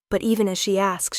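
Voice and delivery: ordinary delivery, nothing marked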